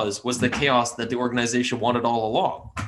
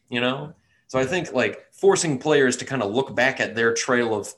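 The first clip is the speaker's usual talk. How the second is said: ordinary delivery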